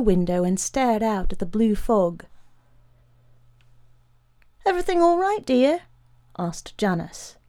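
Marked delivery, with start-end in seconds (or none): 4.7-5.8 higher-pitched wife's voice; high, rising domestic query; enquiring wife. A narrator's reading. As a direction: call the question lightly to a husband. Wife calls question to husband.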